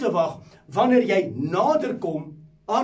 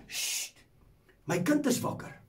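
hushing whisper; 6 semitones higher, 7 dB quieter than usual. Acted. God hushing angels, voiced.

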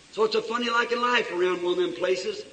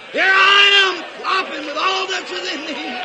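high, full-voiced preaching cry; shout of surrender; 9 semitones higher, 8 dB louder than usual. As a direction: throw yourself at God with a shout. Eaglet surrenders wholly to God.

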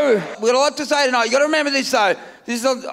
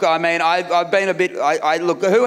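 normal talking voice

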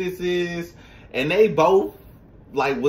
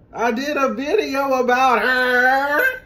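high, slow sing-song copy of the singer; 10 semitones higher, 4 dB louder, slower than usual. Shown as a demonstration. Imitating the singer for fans. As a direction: drawl it out like the diva. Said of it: "who does that sound like".

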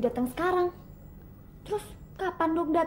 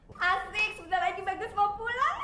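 excited cheer; 8 semitones higher than usual. Acted. Excited servant hears Bagus returns.

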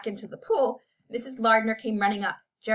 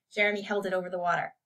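ordinary delivery

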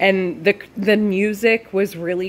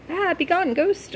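high-pitched playful alarm; hammed-up little panic, pitched up; mock ghost banishing; 11 semitones higher than usual. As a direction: shoo a ghost away in mock fright.

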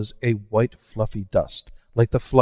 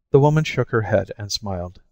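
ordinary delivery, nothing marked

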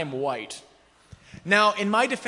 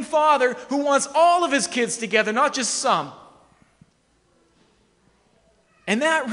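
loud, rising sermon voice, pressing each line home; preaching at full force; 6 semitones higher, 6 dB louder than usual. Acted. Pastor urging the congregation that God is for everyone, preaching loudly. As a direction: build the congregation up with conviction.

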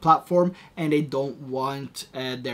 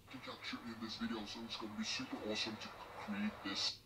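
lowered pitch effect, unnatural timbre; deep pitch shifted; 5 semitones lower, 19 dB quieter, much faster than usual. Shown as a demonstration. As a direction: voice pitched down electronically. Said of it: "So there we have the deep voice".

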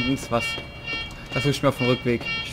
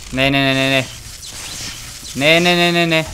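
panicked yelling; 4 dB louder than usual. Real, not acted. Panicking as the enemy closes in.

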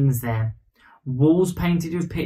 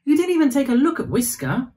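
put-on indignant child; 10 semitones higher, 4 dB louder than usual. A narrator's reading. Child protests to doctor.

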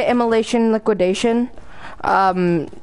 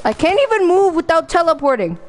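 hyped gamer bro; 9 semitones higher than usual. Real, not acted. Frustrated lag complaint.

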